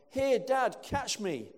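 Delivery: put-on high, loud call of a boy from above; retold yell. Son yelling to his dad from the cliffs, high and loud. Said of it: "I heard a voice from above yell".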